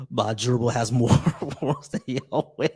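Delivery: laughs through the words, slow; reading while laughing. Real, not acted. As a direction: giggle as you read it out. Reading a viewer comment, laughing.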